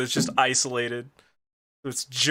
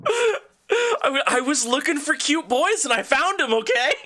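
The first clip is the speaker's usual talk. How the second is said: whiny protest; 11 semitones higher than usual. Real, not acted. Defensive joking protest, high.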